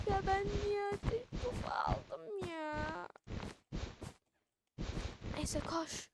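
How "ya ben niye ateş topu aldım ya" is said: mock whining. Real, not acted. Frustrated over a bad pick in game.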